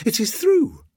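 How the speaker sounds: high, loud exclamation; glad cry. A narrator's reading. Mother announcing her son's tooth, high glad cry. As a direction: cry out the news, pitched right up. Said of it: "cried Missus Aubrey".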